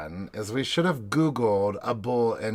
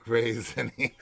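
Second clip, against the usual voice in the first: mimicking raisiny. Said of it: "I only laugh about".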